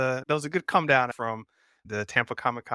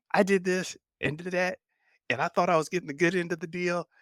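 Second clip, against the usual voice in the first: mildly indignant retold complaint, pitched higher; retold anger; 7 semitones higher than usual. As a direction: imitate an upset man's complaint, understated. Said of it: "He got angry and upset about".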